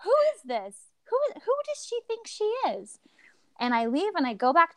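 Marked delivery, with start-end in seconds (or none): 0.0-3.0 high-pitched mock indignation; retold disbelief in a high voice; incredulous outrage. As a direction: act affronted, voice shooting way up. Repeats her outrage at the doctor.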